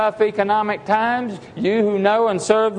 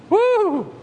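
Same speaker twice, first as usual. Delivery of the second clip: high joyful preacher's whoop; a real whoop of praise; exultant whoop; 11 semitones higher than usual. Real, not acted. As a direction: let out a sudden whoop of joy. Preacher exults mid-verse.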